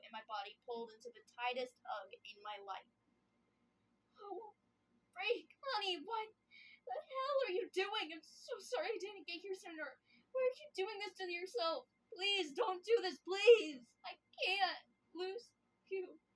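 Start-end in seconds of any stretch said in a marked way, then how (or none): 4.2-14.8 high, crying plea; desperate pleading. Acted. N begs her to stop hurting herself, crying. 15.2-16.2 voice breaking. Acted. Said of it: "His voice breaks on those last two syllables".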